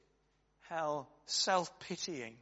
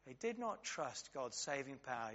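softly repeated; 9 dB quieter than usual. Shown as a demonstration.

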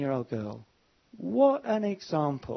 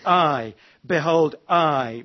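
each "I" punched loud and long; stress on I; 7 dB louder, much slower than usual. Shown as a demonstration. Preacher showing emphasis is on God, stress on I. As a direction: hammer both I's, "behold" between them. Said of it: "the emphasis is more on God".

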